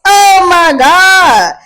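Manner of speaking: loud, drawn-out, fed-up groan of a phrase; exasperated outburst. Real, not acted. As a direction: vent your frustration at yourself, loud and slow. Frustrated at mispronouncing the word.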